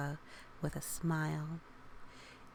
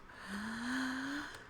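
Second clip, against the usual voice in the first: deep breath out; 6 semitones higher, 4 dB quieter than usual. Shown as a demonstration. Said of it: "She took deep breaths".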